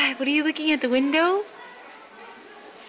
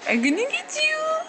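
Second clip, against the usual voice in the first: much higher playful threat; high playful teasing; 13 semitones higher than usual. Real, not acted. Playful chase, rising high.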